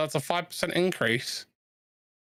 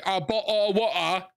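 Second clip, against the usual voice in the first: dropped T Cockney bottle of water; 4 semitones higher than usual. Shown as a demonstration. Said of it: "We don't say".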